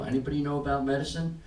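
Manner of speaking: ordinary delivery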